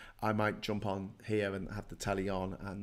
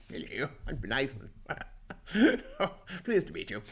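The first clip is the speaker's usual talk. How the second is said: lightly voiced posh young man, giggling; mock a posh kid introducing himself; a hint of a plummy schoolboy; posh boy; 3 semitones higher than usual. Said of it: "He was very posh".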